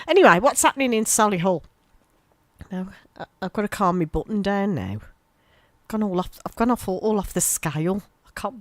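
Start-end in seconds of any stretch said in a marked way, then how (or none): none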